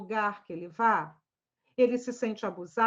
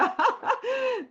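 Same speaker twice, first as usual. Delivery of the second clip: high burst of laughter; 12 semitones higher, 3 dB louder than usual. Real, not acted. Laughs at own punchline.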